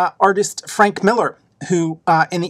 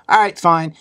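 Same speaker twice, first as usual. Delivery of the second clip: usual speaking voice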